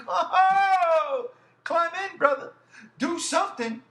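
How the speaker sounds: high, drawn-out exasperated groan into pleading; exasperated urging. Retold or imitated. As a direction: urge a useless hero on, pitch way up. Re-enacts exasperated pleading at the screen.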